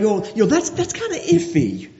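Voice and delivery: high, unsure hedging voice; mocking a hesitant person; wishy-washy doubter. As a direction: play someone shrugging it off as iffy. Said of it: "we use it kinda like".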